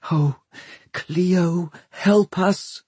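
high, hushed, halting plea; frightened, high-pitched appeal; breathed prayer. A narrator's reading. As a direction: breathe it as a desperate prayer, pausing between words. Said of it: "breathed Catherine".

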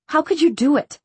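accusing, high and urgent; heated demand. A narrator's reading. Daughter confronts father over killing Simon, heated demand. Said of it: "she demanded".

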